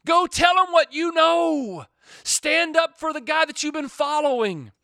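preaching at full force. Acted. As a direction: drive it home to the congregation, full voice.

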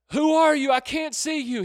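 high, loud panicked calling; frantic. Acted. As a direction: call out in a panic. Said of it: "he's frantic, he's panicking".

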